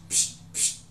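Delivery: short breathy psh bursts, no voice; unvoiced psh sounds. Shown as a demonstration. Teacher imitating a hand slicing hose water, voiceless bursts. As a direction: cut the air like a hand through hose water. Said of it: "you just go psh".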